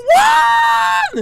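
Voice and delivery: very high shocked squeak; voice got really high. Retold or imitated. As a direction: let the voice jump way up in shock. Shocked high-pitched outburst.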